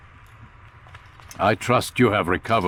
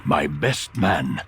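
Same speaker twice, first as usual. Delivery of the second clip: slow stern reproach; 5 semitones lower, much slower than usual. Acted. Al Mualim scolds failed Altair.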